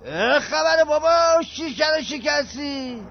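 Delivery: slow, high, loud angry yell; angry shout. Acted. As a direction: bark it out, furious about the glass. Agent yells at clumsy visitor. (داد عصبانی و بلند)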